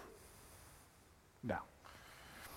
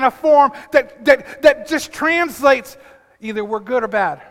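loud, higher preaching voice; fervent preaching; 4 semitones higher, 8 dB louder than usual. Acted. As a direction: preach it with full force. Preaching builds to full force.

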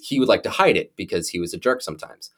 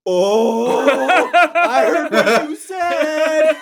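much higher, slow, gloating put-on dad; sing-song gotcha, dragging it out; teasing father caught his kid swearing; mock dad voice; 5 dB louder, much slower than usual. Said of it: "he pokes his head around the corner and he goes".

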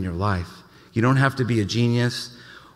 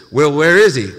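demanding leaders; 4 semitones higher, 9 dB louder than usual. Retold or imitated. Religious leaders demanding Jesus.

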